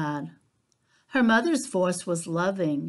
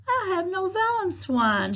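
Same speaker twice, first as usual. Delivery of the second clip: a touch of sighing piglet; high, mopey little pig voice; little pig sighing to her mother; sighed; 12 semitones higher than usual. Acted. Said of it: "she sighed".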